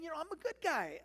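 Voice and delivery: high, loud mock plea; imitated pleading protest. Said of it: "like, pleading with Elijah".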